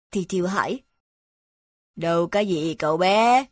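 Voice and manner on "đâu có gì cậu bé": sheep voice. Acted. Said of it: "Cừu nói".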